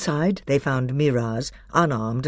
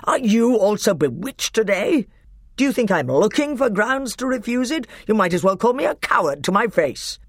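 much higher, louder outrage; outraged king; 10 semitones higher, 4 dB louder than usual. A narrator's reading. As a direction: leap up furious.

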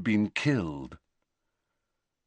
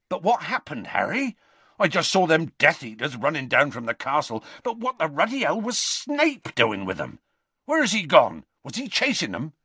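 higher, urgent bewildered questions; puzzled Hagrid; 6 semitones higher than usual. A narrator's reading. Hagrid questioning Harry about Snape, bewildered. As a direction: demand to know what happened.

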